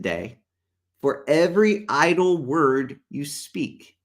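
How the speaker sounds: slow, emphatic Bible reading; measured preacher's reading with long pauses; scripture reading. Real, not acted. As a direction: read the verse out deliberately, weighing each word. Reads scripture aloud.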